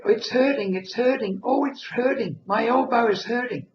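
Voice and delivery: put-on high, loud whimpering baby voice; crying baby voice. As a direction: wail it like a newborn in pain. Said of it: "my voice suddenly became a baby voice".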